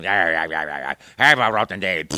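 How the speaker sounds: slow, low, loud sneering villain growl; Wario. Shown as a demonstration. Recording Wario as a real-time character, slow sneering growl. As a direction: drag it out with nasty glee. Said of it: "there was Wario to do".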